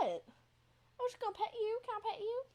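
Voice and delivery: high-pitched cooing to a cat. Real, not acted. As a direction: coax sweetly in a high little voice. Coaxing her pet cat.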